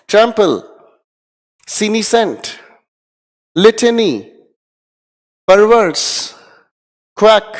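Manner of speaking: teacher leading a loud word drill; loud recital. Shown as a demonstration. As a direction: drill the word list aloud for the class. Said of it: "मेरे साथ ऊंची आवाज में बोलने की कोशिश करें".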